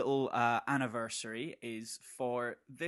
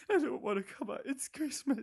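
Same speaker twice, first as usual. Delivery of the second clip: scared whimper; 11 semitones higher than usual. Pretend. Frightened person refusing.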